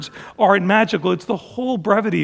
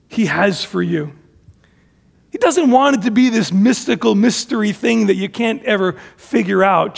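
ordinary delivery

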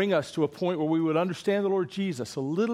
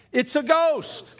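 high, slow, loud cry of fright; shouted ghost line; 12 semitones higher, 5 dB louder, slower than usual. Shown as a demonstration. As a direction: yell it like you just saw a ghost. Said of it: "Come on, wait, wait, no".

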